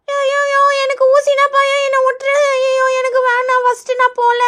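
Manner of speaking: put-on whiny little-kid refusal; frightened reluctant child. Pupil refuses to go first for the injection, frightened whining. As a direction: protest in a scared child's voice.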